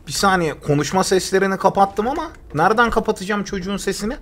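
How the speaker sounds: normal talking voice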